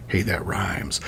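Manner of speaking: low, very soft aside; muttered aside. Real, not acted. Lecturer breaking off a quote to comment, muttered aside. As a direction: drop under your breath for a throwaway comment.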